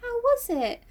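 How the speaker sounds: imitating a sincere, shy girl; sweetly sincere. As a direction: ask it gently in a high little voice. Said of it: "just sincerely".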